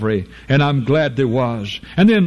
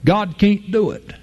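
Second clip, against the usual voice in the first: usual speaking voice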